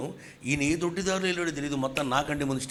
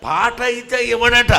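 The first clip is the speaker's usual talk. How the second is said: loud mock complaint; 9 semitones higher, 13 dB louder, slower than usual. Mocking the refusal.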